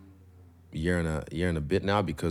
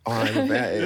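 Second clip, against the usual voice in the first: high, louder mock fan voice with a laugh; mock eager fan; 12 semitones higher, 6 dB louder than usual. Fighter mimics a fan admitting she's a fan, high and laughing. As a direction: play the caught-out fan gushing, laughing. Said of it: "Aren't you a fan?".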